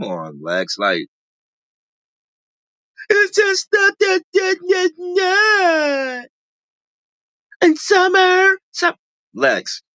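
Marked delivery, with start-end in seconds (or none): 3.1-9.0 very high, whiny mock voice; imitating Lex arguing. Vlogger imitating Lex shouting at Summer in a shrill mock voice. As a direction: squeal it like a petty reality star. Imitating cast member again.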